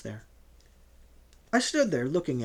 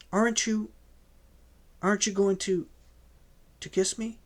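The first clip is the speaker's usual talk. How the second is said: higher, faltering plea; a young woman's shy hurt plea, higher; hesitant young woman; 5 semitones higher than usual. A narrator's reading. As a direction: stumble toward asking for a kiss. Johanna hesitant, pleading.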